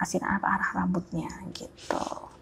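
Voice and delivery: ordinary delivery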